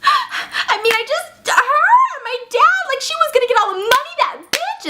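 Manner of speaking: very high, wailing fake sob; mock crying outburst. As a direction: blurt it out in a hammed-up tearful squeal. Acting witness breaking down confessing.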